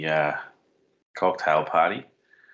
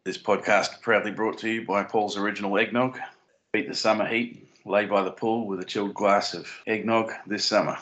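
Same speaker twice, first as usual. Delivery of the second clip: higher-pitched, cheery ad-read pitch; mock sponsor read; 4 semitones higher than usual. Podcaster plugging eggnog as a fake sponsor, mock ad read. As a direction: sell it like a radio sponsor spot.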